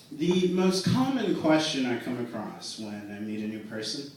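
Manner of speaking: ordinary talk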